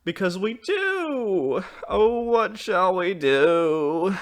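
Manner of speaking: put-on high, loud, long-drawn childish wail; crying out. A narrator's reading. Children answer their mother, crying out. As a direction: wail it in despair. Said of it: "they cried together".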